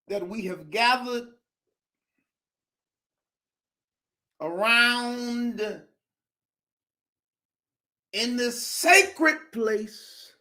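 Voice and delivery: slow, drawn-out sermon cadence; stretched pulpit delivery; preacher voice. Acted. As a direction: preach it to the congregation. I feel like preaching up in here.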